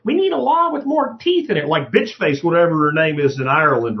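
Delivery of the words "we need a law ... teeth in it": high-pitched mocking demand; mock complainer. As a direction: lightly mimic an indignant lawmaker. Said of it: "somebody comes up and goes".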